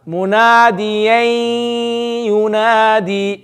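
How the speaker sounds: slow MUNADIYAN YUNADI, long nasal hum on YAN; with gunna. Shown as a demonstration. Teacher corrects a too quick reading, long nasal gunna. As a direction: hold the end of munadiyan through the nose into yunadi. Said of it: "you need to do gunna".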